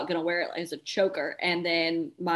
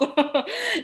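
disbelieving laugh; 12 semitones higher, 3 dB louder than usual. Real, not acted.

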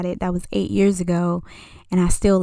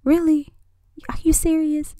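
incredulous; 7 semitones higher than usual. Retold or imitated. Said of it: "I'm looking like".

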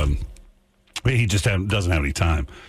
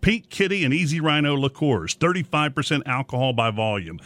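upbeat commercial voiceover; ad read; 7 semitones higher than usual. Acted. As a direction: read it like a radio ad. Recorded ad read.

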